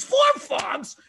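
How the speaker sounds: high shocked cry; shocked shout. Retold or imitated. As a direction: yelp it in disbelief. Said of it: "He says".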